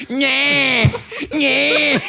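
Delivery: put-on high, whining baby noise; imitated baby cry. Comedian playing the old baby everyone mocks, baby wail. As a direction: wail in short high bursts like a mock baby.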